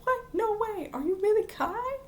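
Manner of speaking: high-pitched, imitated disbelief; mock gasping amazement. Whole class reacts to the changed girl, mock gasping amazement. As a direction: voice an astonished class, pitched right up. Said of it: "the whole class gasps".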